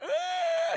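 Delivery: sudden high shout of shock; mock scream. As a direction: yelp in horror at the body. Dad's dead body revealed.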